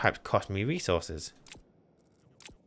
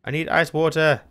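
raised, louder cry of need; desperate plea; 6 semitones higher, 5 dB louder than usual. Real, not acted. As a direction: plead urgently for it. Desperate for water in game.

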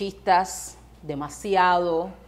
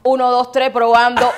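testing the mic at full volume; mock megaphone announcement; megaphone shout; 3 semitones higher, 9 dB louder than usual.

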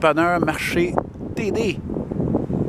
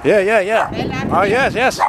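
talking to a dog; 9 semitones higher, slower than usual. Real, not acted.

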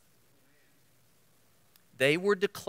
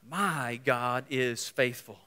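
slow declaration; 3 semitones lower, slower than usual. Acted. Slow declaration after shout.

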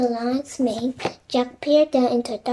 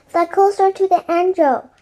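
higher, louder urging; raised storybook shout; shouted command; 6 semitones higher, 5 dB louder than usual. A narrator's reading. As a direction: call out an urgent instruction to the lion. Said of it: "shouted Annie".

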